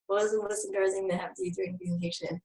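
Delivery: quick, very soft read-back; murmuring to self. Real, not acted. Presenter rereading an audience question while thinking, murmuring to self. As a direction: mumble the question quickly under your breath.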